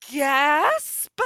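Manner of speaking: huge sucked-in gasp, then the word said high and loud; hammed-up shocked gasp; mock crowd gasp. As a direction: gasp theatrically and announce it. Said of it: "everyone was like".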